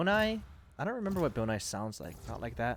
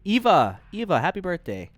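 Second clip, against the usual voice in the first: loud, cheerful birthday call; excited; 5 semitones higher, 8 dB louder than usual. Real, not acted. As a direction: shout the wishes happily. Calling birthday wishes.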